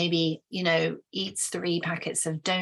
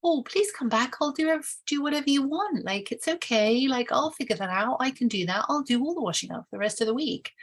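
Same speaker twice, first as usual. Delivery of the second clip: much higher, begging voice; pleading appeaser; 7 semitones higher, faster than usual. Retold or imitated. Partner begging the one walking out to come back, much higher. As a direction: beg them not to leave. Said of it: "somebody else is like".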